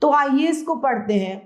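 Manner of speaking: ordinary delivery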